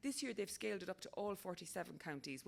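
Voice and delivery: usual speaking voice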